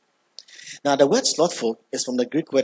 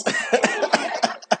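high laugh. Real, not acted. Laughing at the series joke.

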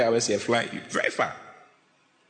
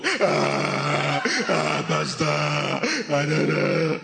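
drawn-out pretend wailing down the phone; mock crying; much slower than usual. Church member phoning the pastor about marriage problems, mock crying. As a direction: bawl theatrically to the pastor, words stretched out. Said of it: "You'll be crying on the phone".

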